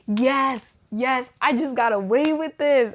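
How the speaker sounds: loud, gloating little celebration; mock triumph. Acting out triumph after negative test.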